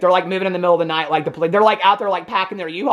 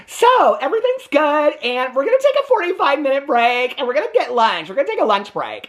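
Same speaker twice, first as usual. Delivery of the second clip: higher, bright announcing voice; mock cheery McKelty; 9 semitones higher than usual. Mimicking McKelty announcing a lunch break, mock cheery. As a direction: chirp it like a fake-happy organizer.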